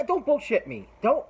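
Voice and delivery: high, loud snapped warning; angry retort. Retold or imitated. Mike tells the petition guy not to lie, angry and loud. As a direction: bark it at him like you've caught a liar.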